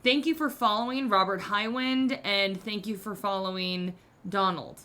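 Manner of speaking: ordinary talk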